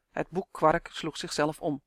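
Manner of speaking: plain reading voice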